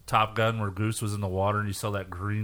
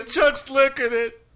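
mock panic; 7 dB louder than usual. Acting astronauts in a mess.

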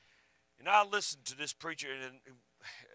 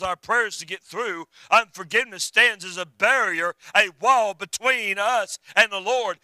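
high, loud pulpit delivery; fired-up sermon voice, ringing out; full-force preaching; 4 semitones higher, 6 dB louder than usual. Real, not acted. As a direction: preach it out with raised pitch and punch.

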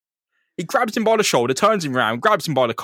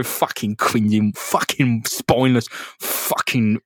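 slow, lower, muttered curses; grumbling under breath; 5 semitones lower, slower than usual. Retold or imitated. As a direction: mutter each insult with simmering rage. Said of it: "chuntering about the fucking insult".

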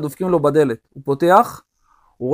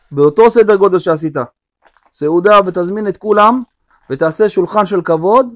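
commanding king; 4 semitones higher, 6 dB louder than usual. Acted. King David commands the rich man.

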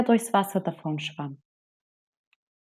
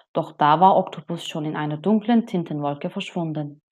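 plain reading voice

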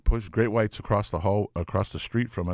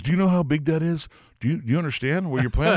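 hammed-up incredulous rocker, higher; mock disbelief, higher; 8 semitones higher, 3 dB louder than usual. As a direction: ask like he can't believe it. [name] voiced, incredulous.

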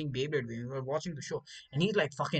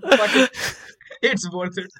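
much louder defiant mock line; hammed-up triumph; 6 semitones higher, 12 dB louder than usual. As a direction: shrug off the injury with bravado. Hurt kid with no regrets.

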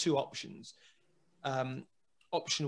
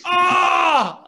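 high loud wail dissolving into laughter; anguished mock scream then laugh; 13 dB louder than usual. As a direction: howl it out then crack up. Sam screams in frustration.

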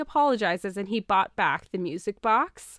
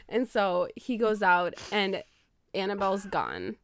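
normal talking voice

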